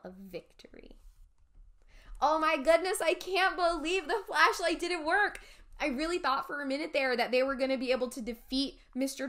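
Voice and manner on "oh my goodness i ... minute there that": higher, loud disbelief; astonished reaction. Real, not acted. Reader to viewers about the failed flashlight, astonished. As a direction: exclaim it as if stunned.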